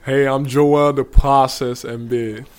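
loud, slow, booming nickname intro; big showman intro with a joking English accent; hyped self-introduction. Shown as a demonstration. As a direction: belt out your own nickname like a ring announcer. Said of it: "Can I just hear you say those three words".

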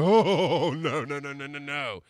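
wavering, laugh-tinged wail that falls away; mock woozy alarm. Drunk man trying to sit up, comic wobbly alarm. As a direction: start loud and wobble down in mock dread. Drunk, room spins on sitting up.

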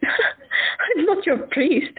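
laughed out slowly, high and amused; laughing. Acted. Stella teasing Tessa about her confession, laughing. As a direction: tease her through the laughter.

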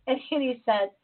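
usual speaking voice

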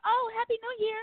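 high, bright mock-surprised woman's reply. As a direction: imitate the startled stranger, squeaky high. Woman answers his New Year greeting.

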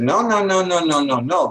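string of protesting no's, starting high and falling; mock conniption fit. Psychologists rejecting Doctor Ellis's new idea, mock indignant protest. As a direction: imitate outraged objectors cutting an idea off. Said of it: "psychology had an absolute conniption fit".

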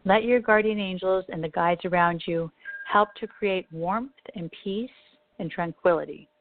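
calm, unhurried meditation voice; guided meditation. Acted. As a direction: lead them gently into calm. Leads a meditation.

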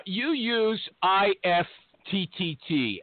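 ordinary talk

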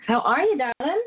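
ordinary delivery

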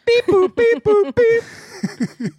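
high squeaky phone beeps. Pretend. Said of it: "I'm calling someone".

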